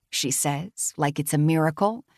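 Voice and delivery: ordinary delivery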